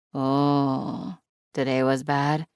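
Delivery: commiserate gently, a touch of sadness; mild sad sympathy. A narrator's reading. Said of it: "she says sadly".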